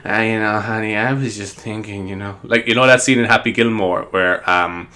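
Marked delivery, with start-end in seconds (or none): 0.1-2.4 a hint of laid-back Sandler; casual Sandler voice. Retelling a Sandler movie, a soft casual voice. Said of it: "he's very kinda like".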